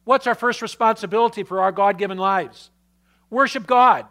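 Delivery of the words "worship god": louder, punched declaration of Worship God; emphatic answer. Real, not acted. Pastor answering the congregation, loud and emphatic. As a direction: call out Worship God with force.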